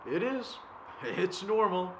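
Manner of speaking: suddenly loud, high outburst; acted burst of heated protest; agitated insistence. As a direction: insist on it, as if arguing back. Insisting against own doubts.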